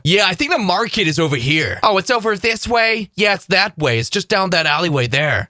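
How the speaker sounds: booming American tourist voices; loud Americans. Retold or imitated. Two Americans talking right next to each other, very loud. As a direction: blare it like an oblivious, loud American. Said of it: "So loud".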